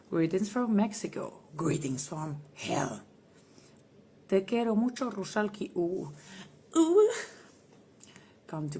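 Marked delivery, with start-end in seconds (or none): 1.6-3.0 slow menacing greeting. Acted. Demonic persona greeting. 6.8-7.1 loud high ooh. Real, not acted. Reacting to fan.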